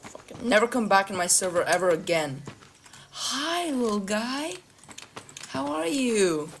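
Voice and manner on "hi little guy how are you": high, slow sweet greeting. Real, not acted. Greeting a small player.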